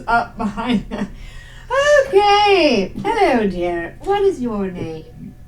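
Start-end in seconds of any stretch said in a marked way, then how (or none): 2.1-5.3 lilting, formal hello to a little one; genteel Agnes greeting. Acted. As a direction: greet a small child with fussy courtesy. Plays Agnes greeting a child.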